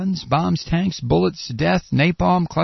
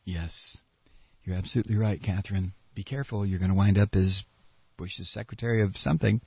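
much softer, lower, dry sarcasm; sarcastic hush; 7 semitones lower, 7 dB quieter, faster than usual. Acted. Comedian teasing the anchor Catherine, soft dry sarcasm. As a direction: murmur it as a sly warning.

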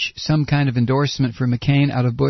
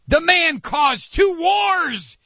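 slow, higher, shouted outrage; slow outraged shout; 13 semitones higher, much slower than usual. Acted. Comedian mocking a heckler's claim, slow outraged shout. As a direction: drag out every word in a shout.

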